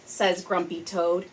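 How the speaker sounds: plain reading voice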